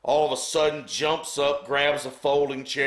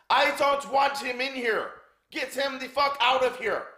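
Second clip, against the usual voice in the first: high-pitched Windsor, Ontario accent impression; 3 dB louder than usual. Butcher ordering the announcer out, high Windsor, Ontario accent. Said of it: "So in his Windsor, Ontario accent".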